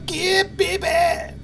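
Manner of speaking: mock yeah baby. Hammed-up catchphrase in gaming chat.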